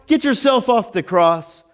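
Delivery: pointed acted challenge; selfish demand. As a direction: demand it for your own sake. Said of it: "he's being kinda selfish there".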